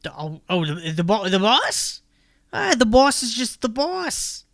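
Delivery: bored toad grumbling about his job; toad folk accent. Acted.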